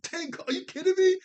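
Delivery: high, loud disbelieving cry; amazed outburst. Real, not acted. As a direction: let the amazement burst out of you. Amazed outburst about the wife.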